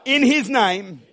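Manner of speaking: loud, phrase by phrase, pauses between; emphatic preacher's call-and-response lead; leading a congregational repeat. Real, not acted. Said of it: "Say this after me".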